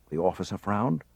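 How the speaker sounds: ordinary delivery, nothing marked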